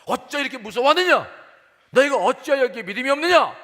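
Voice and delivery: high, very loud angry scolding; shouted rebuke. Acted. Jesus scolding his frightened disciples for lack of faith, shouted rebuke. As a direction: shout it like an outraged master. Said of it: "그리고 막 소리를 질러요".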